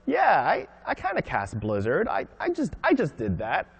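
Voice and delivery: lightly acted player reaction, pitch jumping up; imagined player. Player reacting to a cast spell, lightly acted. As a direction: voice a player's halting, high-starting reaction. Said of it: "where you're like".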